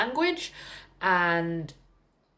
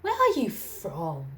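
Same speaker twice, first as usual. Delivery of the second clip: imitating the lady; slower than usual. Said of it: "she looked at me and went".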